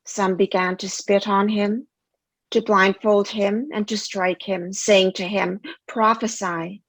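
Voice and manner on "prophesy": normal talking voice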